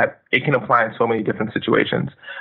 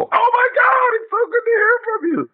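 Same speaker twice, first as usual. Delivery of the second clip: fake over-the-top excitement. Shown as a demonstration. Showing an inauthentic callback greeting, faked gushing delight. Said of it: "that clearly is not, you know, authentic".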